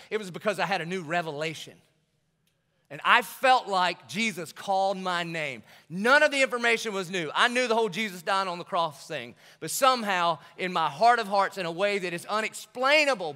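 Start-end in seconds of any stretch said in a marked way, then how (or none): 2.9-13.3 louder earnest preaching; preaching at full force. Real, not acted. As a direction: preach it out with conviction. Testimony building to full force.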